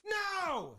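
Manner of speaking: high, whiny manager protest, far above his own voice; hammed-up pleading no; Michael Scott style protest. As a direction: wail no like a sitcom boss losing it. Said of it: "Straight Michael Scott".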